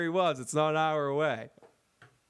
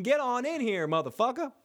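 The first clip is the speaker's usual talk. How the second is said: calling out; 8 semitones higher than usual. Pretend. Calling out again.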